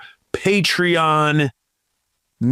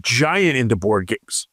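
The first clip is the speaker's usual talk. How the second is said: ordinary talk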